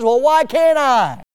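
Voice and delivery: high, loud, slow indignant protest; Peter protesting. Retold or imitated. Peter objecting to the Lord, high and loud. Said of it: "Peter's response is".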